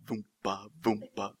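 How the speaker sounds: low, soft saw noise; low rhythmic sawing imitation; imitated sawing sound. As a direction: mimic a saw with your mouth, low and soft. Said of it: "he's sawing".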